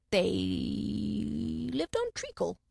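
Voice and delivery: very slow, drowsy dormouse; sleepy dormouse. A narrator's reading. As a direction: drag it out sleepily.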